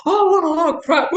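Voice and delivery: high, loud laughing imitation of the husband; laughing mock of husband. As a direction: laugh while doing the husband's scoff. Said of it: "And he went".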